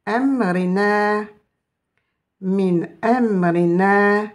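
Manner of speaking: "amrina" drawn out very slowly; "min amrina", each syllable stretched; slow recitation. Shown as a demonstration. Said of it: "avec la voyelle E".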